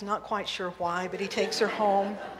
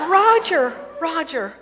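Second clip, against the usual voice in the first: higher and louder call; calling Roger; 8 semitones higher, 10 dB louder, slower than usual. Acted. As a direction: Elaine breaking the silence in the car, calling out higher and louder. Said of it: "And Elaine says".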